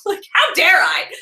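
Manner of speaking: incredulous. Real, not acted. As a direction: throw it back in disbelief. Reacting to angry viewers.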